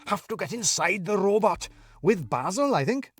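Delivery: a scatty man pleased with himself; eccentric Daniel. Acted. Daniel explains the sauce to Alfie in his odd high voice.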